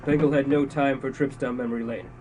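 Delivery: normal talking voice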